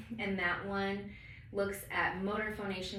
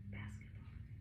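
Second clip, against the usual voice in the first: whispered test word, low and soft; whispering; 10 semitones lower, 14 dB quieter, slower than usual. Shown as a demonstration. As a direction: whisper it quietly. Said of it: "whisper test".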